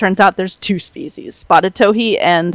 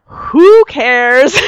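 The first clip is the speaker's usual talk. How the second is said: drawn-out, raised "who cares"; hammed-up heckle, high and loud; mock indifference; 6 semitones higher, 6 dB louder than usual. Imagined listeners who don't care about birds, mock-dismissive. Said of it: "all you guys who don't care".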